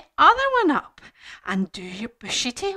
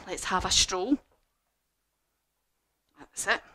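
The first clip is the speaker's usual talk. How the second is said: ordinary delivery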